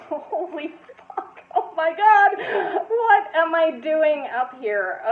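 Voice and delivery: high, laughing panic, slowing at the end; panicked disbelief. Retold or imitated. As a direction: freak out on stage and laugh at yourself. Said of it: "And I'm like".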